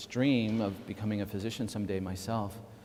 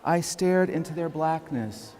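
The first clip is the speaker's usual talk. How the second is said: slow, measured poem recitation with lifted pitch; deliberate poet's cadence, phrases drawn out and set apart; poetry reading; 4 semitones higher, slower than usual. Acted. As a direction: read it as solemn verse, pausing after each line. Reads own poem aloud.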